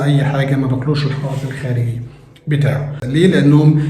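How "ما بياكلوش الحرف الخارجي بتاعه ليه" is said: usual speaking voice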